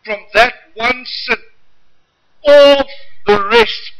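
slow, loud, high preaching climax. Acted. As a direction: build and hammer it slowly.

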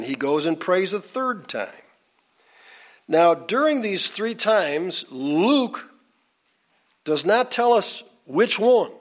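high-pitched, measured pulpit delivery; preaching narration. Real, not acted. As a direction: proclaim the story phrase by phrase in a lifted voice.

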